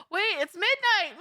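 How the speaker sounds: sudden high gasp; surprised. Real, not acted. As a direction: gasp and shout it. Sudden realization of midnight.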